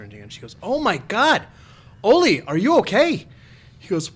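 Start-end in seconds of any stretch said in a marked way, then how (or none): 0.6-3.3 alarmed high South Dakota wife; South Dakota accent. Acted. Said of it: "I like how you did the South, uh, Dakota".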